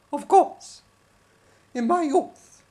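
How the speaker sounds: high, loud puppet woman; puppet wife voice. Acted. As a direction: squawk it like Judy. Judy puppet voice.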